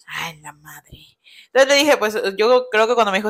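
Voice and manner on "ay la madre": whispered oath of dismay; retold shock. She reacts to him being outside, shocked whisper. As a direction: let it out under the breath, whispered.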